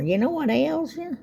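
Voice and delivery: a hint of an old-fashioned granny asking; old-timey folksy saying. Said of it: "I like that old saying".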